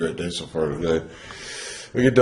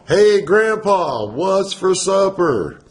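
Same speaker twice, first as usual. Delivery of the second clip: high, drawn-out country holler; hammed-up country twang; country accent; 10 semitones higher, 4 dB louder, much slower than usual. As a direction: holler it slow and high like a hillbilly. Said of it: "you going country on me".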